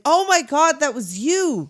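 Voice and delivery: high, loud gushing imitation; mock amazed delight. Lawyer greeting Patrick about his confession, mock amazed delight. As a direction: put on an over-the-top "oh my God" reaction.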